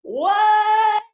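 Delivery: high, loud squeal of surprise; shocked what. Real, not acted. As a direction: gasp it out, truly amazed. Hears about twenty pounds lost.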